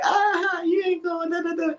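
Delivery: very high put-on girlish voice; high teasing imitation of her; mock girlfriend squeal.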